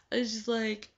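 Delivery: usual speaking voice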